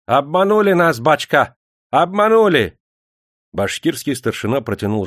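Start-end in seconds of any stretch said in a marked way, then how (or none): 0.1-2.8 loud high lament; wailing cry. A narrator's reading. Bashkirs on their knees crying out to the official, loud wail. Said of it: "возопили".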